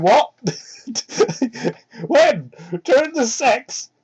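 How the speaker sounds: shocked disbelief. Real, not acted. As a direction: ask in stunned disbelief. Shocked reaction to the story.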